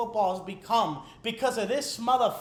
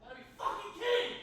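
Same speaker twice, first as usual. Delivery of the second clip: exasperated outburst; 7 semitones higher, 11 dB quieter, much faster than usual. Real, not acted. Fighting with a broken device.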